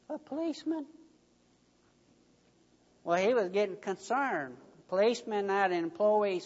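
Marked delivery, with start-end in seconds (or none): 0.1-0.9 put-on soft, high hushed child's voice; child's whisper. A narrator's reading. Child whispering to the boss about the policeman. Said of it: "the little voice was whispering".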